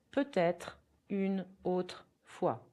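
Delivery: slow, careful French; teacher demonstrating for learners; slow repeat-after-me. Shown as a demonstration. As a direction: model it slowly for repetition. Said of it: "You can repeat".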